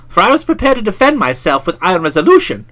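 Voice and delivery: ordinary delivery, nothing marked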